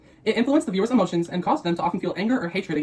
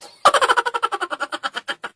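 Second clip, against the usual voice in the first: high burst of laughter; 12 semitones higher than usual. Real, not acted. Reacts to her hungry classmate.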